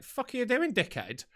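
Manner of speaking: high-pitched indignant jab, dropping at the end; retold angry challenge. Driver challenges queue-jumper, acted outrage. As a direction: re-enact yelling out of a car window. Acting out row at petrol station.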